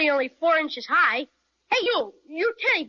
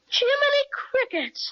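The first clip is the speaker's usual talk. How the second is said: high, slow gasp of wonder; drawn-out boyish amazement; amazed exclamation; 9 semitones higher than usual. Acted. Boy stares at the bear in amazement.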